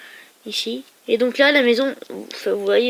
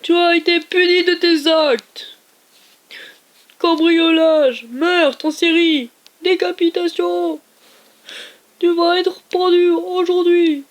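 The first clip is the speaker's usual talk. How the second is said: voix de bourreau plus aiguë, lente et forte; bourreau solennel; 6 semitones higher, 4 dB louder, slower than usual. Acted. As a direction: annonce la sentence lentement, en juge théâtral. Panneau du pendu, voix de bourreau. (le bourreau lit la sentence au condamné, voix lente et solennelle)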